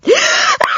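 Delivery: sharp high intake before sneezing; big breath before the sneeze; gasp before a sneeze. A narrator's reading. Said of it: "The spider sneezed".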